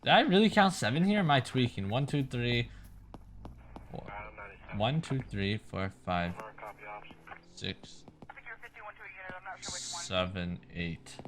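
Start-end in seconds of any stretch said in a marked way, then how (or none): 1.9-11.2 quiet counting to himself; counting under breath. Real, not acted. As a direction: count the votes low, almost to yourself. Recounting votes quietly to himself.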